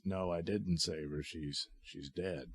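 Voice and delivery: quiet, hollow disbelief; stunned grief. Retold or imitated. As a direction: let it sink in, softly. Spider-Man discovers Gwen is dead.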